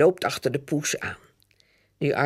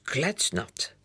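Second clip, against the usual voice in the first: ordinary delivery, nothing marked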